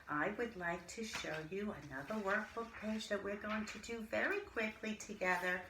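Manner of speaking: ordinary delivery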